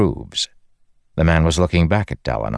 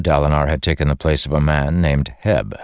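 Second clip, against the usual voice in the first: plain reading voice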